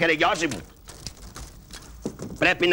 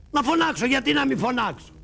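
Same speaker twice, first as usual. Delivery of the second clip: pitched up high; high-voiced objection; high squeaky protest; 7 semitones higher than usual. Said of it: "να φωνάξεις κι εσύ".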